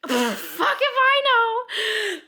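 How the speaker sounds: loud, high, drawn-out mock wail; clueless outburst. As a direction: blurt it out long and high, totally clueless. Acted clueless reaction when merging.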